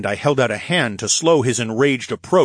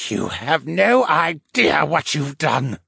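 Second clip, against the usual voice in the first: slow, higher-pitched captive merman; muttered; 6 semitones higher, slower than usual. A narrator's reading. Captive merman to his captor, muttered and slow. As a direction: draw it out slowly, pitched up. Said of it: "he muttered".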